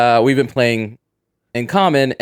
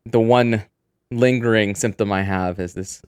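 ordinary talk, nothing marked

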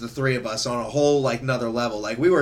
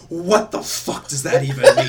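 mock angry, laughing; 5 semitones higher, 5 dB louder than usual. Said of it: "so angry".

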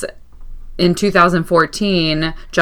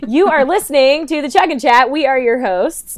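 goofy podcast intro; 9 semitones higher than usual. Acted.

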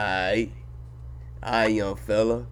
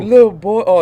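loud; 9 semitones higher, 10 dB louder than usual. Acted. Excited shout mid basketball talk.

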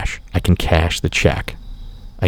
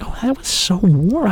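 much higher and slow, dreamy gush; hammed-up smitten sigh; mock swooning; 12 semitones higher, slower than usual. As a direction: swoon over it, slowly. Gushing over the warm greeting.

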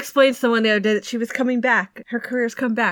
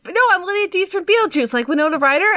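exasperated explaining; 9 semitones higher than usual. Retold or imitated. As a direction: explain it with disbelief. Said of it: "And I was like".

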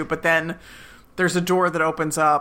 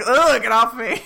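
annoyed brush-off; 5 semitones higher, 4 dB louder than usual. Retold or imitated. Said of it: "bad Janet's like".

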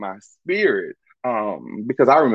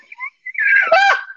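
shrieking laugh. Real, not acted. Telling viewers his confession, a shrieking laugh. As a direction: crack up in a screaming laugh. Laughing shout after reveal.

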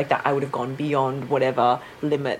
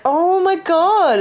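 high, very slow "Oh my God"; awed gasp; 12 semitones higher, 6 dB louder, much slower than usual. Retold or imitated. New mother seeing her baby, slow and awed. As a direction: stretch it out in wonder. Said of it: "But then you're like".

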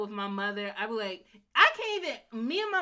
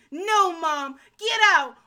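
mock shout; 9 semitones higher, 10 dB louder, slower than usual. Jasmine throwing her mother out.

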